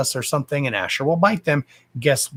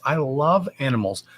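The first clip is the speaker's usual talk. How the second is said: normal talking voice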